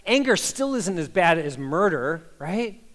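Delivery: usual speaking voice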